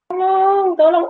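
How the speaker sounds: high, drawn-out plea for help; cry for help. Retold or imitated. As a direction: imitate a woman calling for help. Perempuan di lumpur minta tolong. (minta tolong bernada tinggi)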